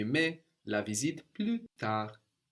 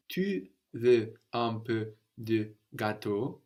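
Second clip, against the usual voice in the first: "Tu veux un peu de gâteau?" said slowly and clearly; slow model; slower than usual. Shown as a demonstration. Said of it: "Very good".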